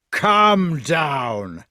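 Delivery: loud, long-drawn shout; bellowed order. A narrator's reading. Fat knight to the man up the tree, bellowing. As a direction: bellow it up at him.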